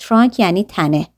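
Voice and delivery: usual speaking voice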